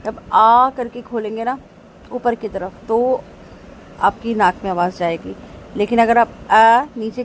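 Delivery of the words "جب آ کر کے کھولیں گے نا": ordinary delivery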